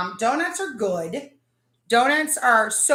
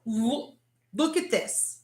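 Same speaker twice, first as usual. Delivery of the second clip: normal talking voice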